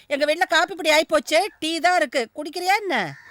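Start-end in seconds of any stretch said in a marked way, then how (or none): none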